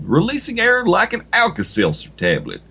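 ordinary talk

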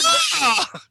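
high, loud mock shocked cry; shocked scream. Redoing the reaction as a shocked scream. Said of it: "that should be more of a shocked scream".

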